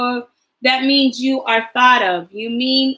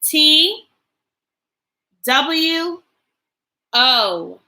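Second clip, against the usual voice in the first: letter by letter, clear and paced; spelling aloud; 4 semitones higher, 4 dB louder, much slower than usual. Shown as a demonstration. Teacher spelling a sight word for the class, letter by letter. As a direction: spell it out slowly for little ones.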